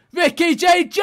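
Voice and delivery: loud, high rising yell of the name; excited shout. Real, not acted. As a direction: scream his name as he runs in. Shouting as striker breaks through.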